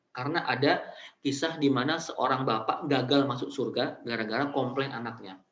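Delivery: ordinary delivery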